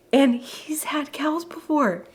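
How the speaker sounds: slow whispered aside; whispering. Real, not acted. Confiding aside.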